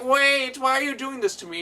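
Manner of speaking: high, loud panicked villain; hammed-up whiny plea; pleading villain. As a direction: beg like a cornered cartoon villain. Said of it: "the Sewer King's like".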